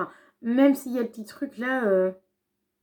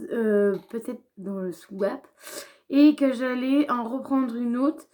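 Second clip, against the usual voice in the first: usual speaking voice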